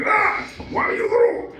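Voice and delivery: high strangled Homer Simpson yell; Homer Simpson rant. Retold or imitated. Dad imitates Homer Simpson yelling at a kid, high and strangled. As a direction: yell it like Homer throttling Bart. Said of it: "Homer Simpson, I'm like".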